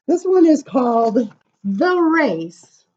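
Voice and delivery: bright, lilting read-aloud voice; reading to children. Acted. Reading a funny giraffe poem to children, sing-song. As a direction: read the rhyme playfully to the kids.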